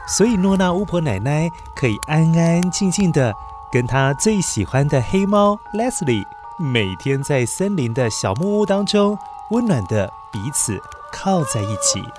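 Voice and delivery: usual speaking voice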